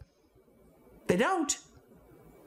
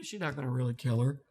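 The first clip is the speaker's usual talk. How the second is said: normal talking voice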